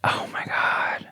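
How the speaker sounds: unvoiced shocked whisper. Real, not acted. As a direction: whisper it like a secret.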